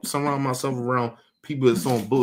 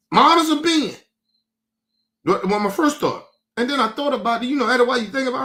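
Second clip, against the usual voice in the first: much higher, louder incredulous rant; animated, half-laughing disbelief; a father exaggerating his own shock; worked-up retelling; 8 semitones higher, 3 dB louder than usual. Retelling his shocked first thought.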